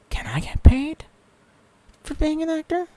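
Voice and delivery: high breathy whisper, half joking. Real, not acted. Streamer whispering a joke about paid acting. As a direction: whisper it like a sly idea.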